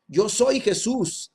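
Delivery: high, slow proclamation; solemn declaration. Retold or imitated. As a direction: declare who you are, stretching each word. Jesus answers Saul, declaring himself.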